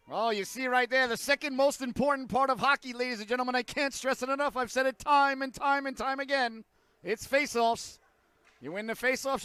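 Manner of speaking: high, emphatic chain of escalating phrases; fired-up broadcaster rant. Real, not acted. Broadcaster preaching faceoffs to the audience, fired-up rant.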